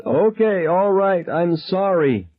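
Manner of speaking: very high broken cry, slowing; screamed surrender. A narrator's reading. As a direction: scream the apology out.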